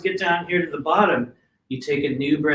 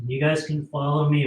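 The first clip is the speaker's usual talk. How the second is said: ordinary talk, nothing marked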